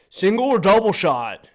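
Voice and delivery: loud, high, curt question; aggressive bartender. Retold or imitated. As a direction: bark the question at him.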